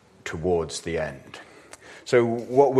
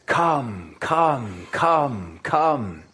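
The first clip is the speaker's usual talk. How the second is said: each come rising, louder; dramatic repeated call; 5 semitones higher, 4 dB louder, much slower than usual. Retold or imitated. Preacher calling the hearers dramatically. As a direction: call them in, building up. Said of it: "It starts dramatically".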